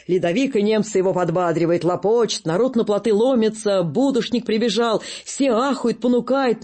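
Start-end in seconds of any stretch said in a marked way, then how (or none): none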